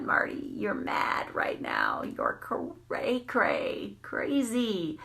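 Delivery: hushed whispered self-check, slowing right down. Retold or imitated. Catching herself mid-chase, she whispers a self-check. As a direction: whisper a warning to yourself.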